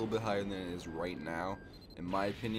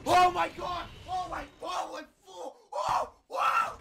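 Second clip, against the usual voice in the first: very high, loud screaming of Oh my god; freak-out screams; 5 dB louder, slower than usual. Real, not acted. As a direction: lose it completely over the pull. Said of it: "out of breath from that freak out".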